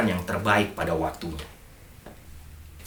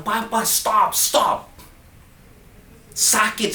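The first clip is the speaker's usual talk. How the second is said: much higher, pleading child's cry, retold; mock child's cry, pitched way up; child's pleading scream; 11 semitones higher, much slower than usual. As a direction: voice the little girl begging her papa to stop. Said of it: "dia teriak-teriak".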